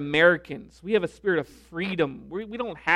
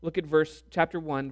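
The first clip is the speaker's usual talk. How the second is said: ordinary delivery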